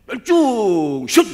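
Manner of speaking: loud, high barked army command; drill sergeant. Retold or imitated. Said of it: "널 부르더니". (구령 외치는 군대 교관)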